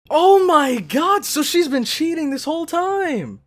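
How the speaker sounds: high and loud, stretched oh my god; outraged realization spilling out; shocked outburst. Real, not acted. Viewer realizes the mother has been cheating, loud shocked outburst.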